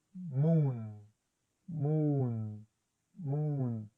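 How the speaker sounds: "Moon" with a long drawn-out oo. Shown as a demonstration. Teacher modelling the long oo for students. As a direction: stretch the oo in "Moon". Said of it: "es una U larga".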